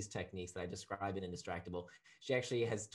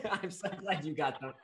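laughing; 10 semitones higher, 5 dB louder than usual. Real, not acted. Guest laughing about jokes.

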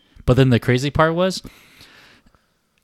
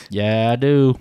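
put-on Southern accent; imitated Southern speech, pitch near usual; 4 dB louder, slower than usual. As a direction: answer back in a playful Southern accent. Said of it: "like a Southern accent".

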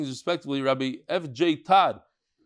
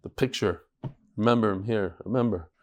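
choked up; 6 semitones lower than usual. Real, not acted. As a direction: voice low and thick with emotion. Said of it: "Choking up".

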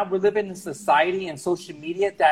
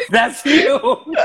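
laughing loud and high; loud laughter; 9 dB louder than usual. Real, not acted. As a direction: crack up loudly. Laughing at friend's joke.

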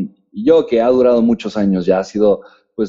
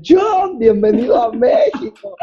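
high, gushing greeting, laughing through it; overjoyed high welcome; excited welcome; 13 semitones higher, slower than usual. Retold or imitated. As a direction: greet him like a thrilled fan. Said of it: "así de".

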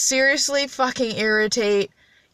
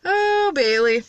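high, drawn-out "Oh", falling on the name; mock reaction; 5 semitones higher, slower than usual. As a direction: stretch it out in playful mock dismay. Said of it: "listen to this shit later and be like".

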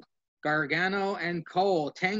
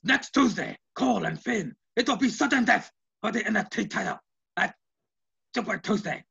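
imitating Regal; 3 semitones higher than usual.

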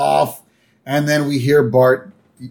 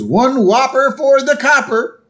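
much higher cartoon voice; goofy dad tossing a line; pitched-up Homer impression; 13 semitones higher, 5 dB louder than usual. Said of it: "Homer just goes".

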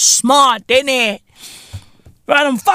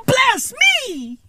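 ordinary delivery

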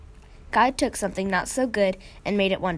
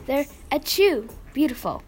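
talking through a sneeze; 5 semitones higher than usual. A narrator's reading. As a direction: sneeze breaking the words, slowed. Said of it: "sneezed Mom".

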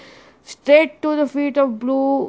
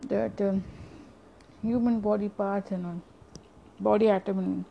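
guided meditation; 5 dB quieter than usual. Acted. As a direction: ease them gently into stillness. Leads breathing meditation.

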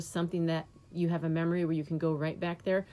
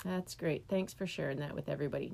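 sarcastic; 3 semitones lower, 7 dB quieter than usual. Retold or imitated. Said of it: "I'm like".

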